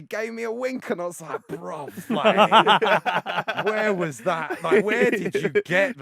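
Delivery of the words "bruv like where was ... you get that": amazed disbelief. Retold or imitated. Amazed at the man's lie.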